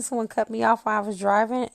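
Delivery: usual speaking voice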